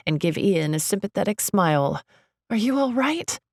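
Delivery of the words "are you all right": concerned. A narrator's reading. Concerned for Ian.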